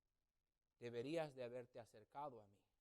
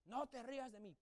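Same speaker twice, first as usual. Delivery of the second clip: stern grandfather; 8 semitones higher, 3 dB quieter than usual. Retold or imitated. Grandfather warning before slap.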